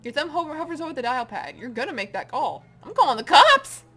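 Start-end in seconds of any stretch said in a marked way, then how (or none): 2.8-3.8 panicked shout. Acted. Threatening to call police.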